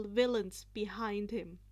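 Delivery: ordinary delivery, nothing marked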